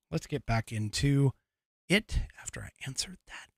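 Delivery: quick whispered aside. Real, not acted. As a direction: whisper it fast to yourself.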